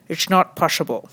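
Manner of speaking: put-on comic Dutch accent refusal. Comedian quoting the phrase heard over and over, Dutch accent. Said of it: "my Dutch accent is so amazing".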